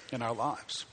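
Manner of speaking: ordinary delivery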